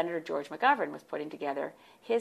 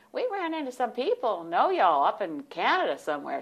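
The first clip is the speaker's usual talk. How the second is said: lightly voiced Southern bus driver, pitched up; Southern accent; 6 semitones higher, 4 dB louder than usual. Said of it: "they had this soft Southern accent".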